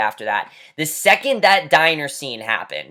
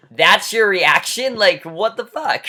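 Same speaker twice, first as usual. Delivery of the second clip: high, slow, incredulous; mock outrage; 5 semitones higher, 4 dB louder, slower than usual. Vlogger mocking the character's flat reaction, incredulous. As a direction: let the disbelief climb slowly. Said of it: "I'm just like".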